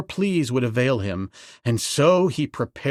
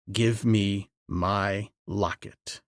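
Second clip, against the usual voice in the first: low, slow command; 6 semitones lower, 6 dB quieter, slower than usual. A narrator's reading.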